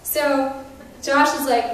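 ordinary delivery

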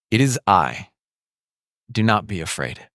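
low, steady, comforting; Jesus calming his frightened disciples, low and gentle; calm reassurance. Acted. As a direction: speak peace to frightened friends.

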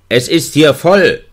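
lauter, höher gerufen; Ruf. A narrator's reading. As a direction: ruf durch die Eisentür. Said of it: "rief ich". (laut gerufen durch die Tür)

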